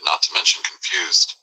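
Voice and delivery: normal talking voice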